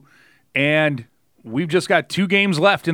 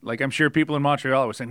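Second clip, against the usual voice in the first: ordinary talk, nothing marked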